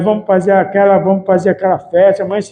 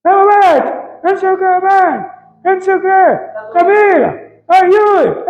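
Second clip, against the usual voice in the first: imitating his wife; 8 semitones higher than usual.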